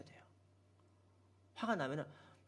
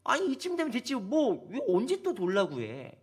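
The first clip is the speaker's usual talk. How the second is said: angry complaint; 3 semitones higher, 5 dB louder than usual. Retold or imitated. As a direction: shout the protest at God. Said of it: "이렇게 화가 나는 거예요". (하나님께 화내며 따지는 목소리)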